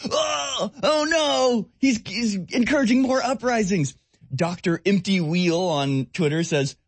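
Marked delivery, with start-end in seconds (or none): 0.1-3.9 high, hammed-up gasp of horror; mock shock. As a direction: play the shocked liberal, way up high. Mock outrage at liberals.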